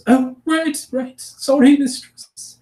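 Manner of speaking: high, squeaky goblin apology; quickly correcting itself, squeaking; flustered goblin. Acted. Goblin apologising to her mistress, high and squeaky. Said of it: "broken story".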